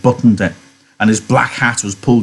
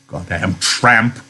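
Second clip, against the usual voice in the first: slow, bitter muttered insult; vicious curse; 3 semitones lower, 4 dB quieter, slower than usual. A narrator's reading. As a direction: spit it out with contempt, slowly. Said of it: "he said viciously".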